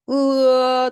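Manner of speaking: 으 held high and loud, gliding towards 어; gliding vowel. Shown as a demonstration. Teacher models the vowel to copy, long loud 으 opening to 어. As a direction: stretch 으, slowly opening into 어. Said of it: "으에서 어로".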